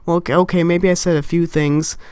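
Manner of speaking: ordinary talk, nothing marked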